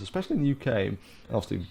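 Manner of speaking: normal talking voice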